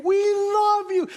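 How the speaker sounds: high, loud, drawn-out holler; calling out. Acted. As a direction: holler it long and high to the whole building. Calling out to nursing homes.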